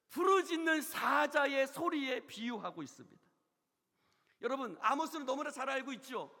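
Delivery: loud, high-pitched pulpit shout; full-force preaching. Real, not acted. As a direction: preach it out like a roar.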